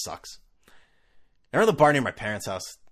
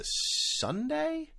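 unsure question; 5 dB quieter than usual. Real, not acted. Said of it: "when am I going?".

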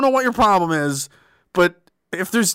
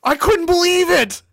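ordinary talk, nothing marked

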